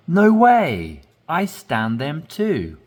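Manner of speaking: No way said high and loud in surprise; surprised emphasis. Shown as a demonstration. Teacher demonstrating surprise for learners, high and loud. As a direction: burst out No way, amazed. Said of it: "So you're emphasizing".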